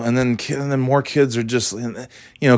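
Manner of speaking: ordinary talk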